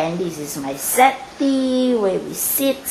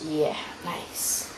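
whispered aside; 6 semitones lower, 13 dB quieter than usual. Real, not acted. Whispered echo of own remark.

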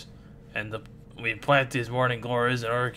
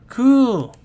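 excited shout; 10 semitones higher, 9 dB louder than usual. Acted. Excited outburst in cartoon.

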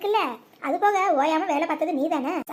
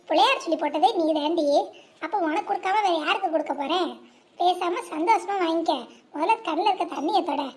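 normal talking voice

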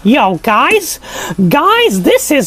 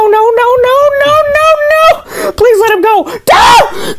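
hammed-up panic, building to a shriek; mock pleading; 10 semitones higher than usual. Pleading for Donald's babies.

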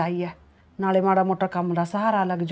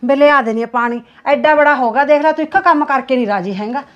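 louder, rising irritated scolding; mother losing patience with her son over water; 4 semitones higher, 9 dB louder than usual. Acted. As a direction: snap at the grown son who won't fetch his own water.

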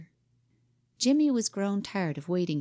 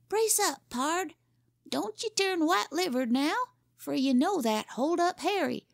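put-on much higher boy putting on a gunfighter swagger; a kid hamming up a Wild West tough; boy playing Western outlaw; 11 semitones higher than usual.

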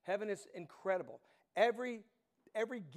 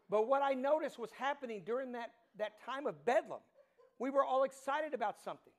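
high, loud, fired-up pulpit delivery; excited preaching; 4 semitones higher, 5 dB louder than usual. Acted. Pastor reliving the stadium joy for his congregation, excited and loud. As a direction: preach it like the crowd is still roaring.

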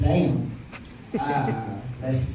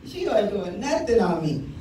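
normal talking voice

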